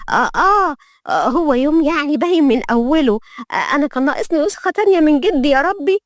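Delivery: high-pitched comic grumbling; exasperated young maid. Acted. Maid complaining to herself about the day, exasperated. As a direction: grumble to yourself in a high, put-upon voice.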